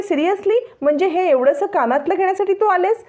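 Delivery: high, mocking disbelief; sarcastic disbelief. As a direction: scoff at a flimsy excuse. Rohini mocks Naina's excuse. (उपहासाने, उंच आवाजात अविश्वास)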